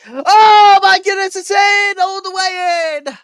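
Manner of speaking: loud high goal shout; long goal yell. Real, not acted. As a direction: belt out the goal. Goal scored, commentator yells.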